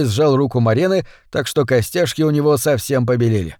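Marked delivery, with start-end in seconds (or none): none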